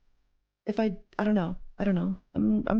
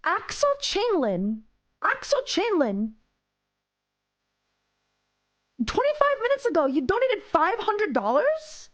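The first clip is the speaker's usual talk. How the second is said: high, incredulous cry, words stretched out; stunned disbelief; 10 semitones higher, slower than usual. Real, not acted. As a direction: gasp it out in real shock. Discovers a missed five hundred dollar donation.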